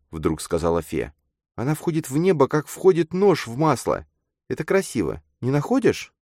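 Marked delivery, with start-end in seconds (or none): 1.5-6.1 put-on high-pitched girl's voice; eager girl character. A narrator's reading. Girl shows her friend the tower, eager. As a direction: draw his attention in a high feminine voice.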